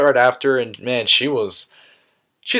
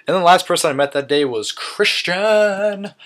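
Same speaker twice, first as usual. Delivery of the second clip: a higher, hammed-up announcer lead-in; dramatic announcer build-up; 7 semitones higher than usual. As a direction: build up the big reveal like a ring announcer. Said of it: "That was lame, I'm sorry".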